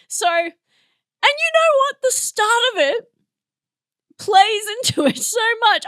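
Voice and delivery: high, loud, animated explaining; worked-up excitement. Real, not acted. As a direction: get carried away, pitch and volume jumping up. Worked up explaining the film confusion.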